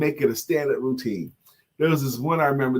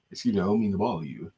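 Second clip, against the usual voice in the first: lower, quick, lightly put-on English accent; mock English accent; 6 semitones lower, 4 dB quieter, faster than usual. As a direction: apologise politely in a playful English accent. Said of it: "using an English accent".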